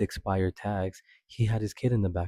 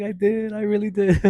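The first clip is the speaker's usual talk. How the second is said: very high and loud, bursting with joy; excited relief; 13 semitones higher, 7 dB louder than usual. Retold or imitated. Telling the judge she did it, very high excited shouting. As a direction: shout it like you can't believe it's over.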